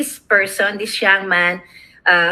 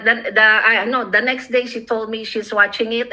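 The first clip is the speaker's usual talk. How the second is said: ordinary delivery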